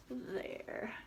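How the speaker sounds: hushed whisper. Real, not acted. Aside to kids showing picture.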